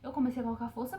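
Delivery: normal talking voice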